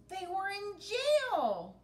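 high, loud, slow alarmed cry. Acted. Dots reaches the top and finds the crew jailed, crying out. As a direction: cry out the bad news. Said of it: "cried Dots".

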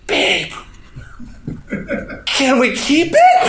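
high, slow pleading wife voice with a laugh. Retold or imitated. Wife begging her husband to keep the stray, high pleading. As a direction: plead sweetly to keep the dog. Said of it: "She goes".

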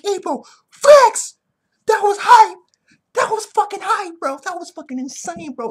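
high-pitched screaming fan excitement; ecstatic hype. Real, not acted. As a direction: yell it out, then keep squealing with hype. Fan exulting over the knockout.

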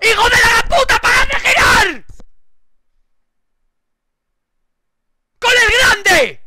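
raging scream at the game; furious shout. Real, not acted. Streamer raging at a spinning game, furious scream.

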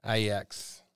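Ajax as EYE-ex, silent J; Dutch-style pronunciation. Shown as a demonstration. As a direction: say "Ajax" with a long I and a Y glide. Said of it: "if it's an I".

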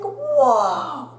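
put-on high, slow awed kid voice; drawn-out wow from amazed classmates; impressed kids. Classmates impressed by the broken tooth, awed. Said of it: "they're like".

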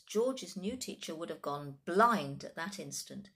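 plain reading voice

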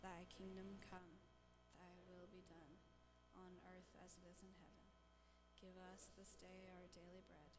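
prayer recitation. Real, not acted. Congregation praying aloud together, soft and steady.

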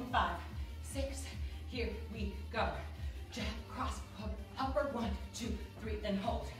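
quiet clipped count calls to the class; boxing coach calling combos. Acted. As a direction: call the combo like a fitness coach.

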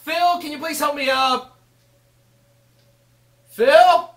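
high plea rising to a shout; calling for help. Acted. Dan calling Phil to help him up, high pleading call. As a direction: call out for a hand.

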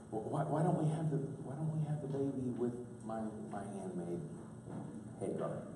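put-on low, quiet old woman. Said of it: "let me kick into my old woman voice".

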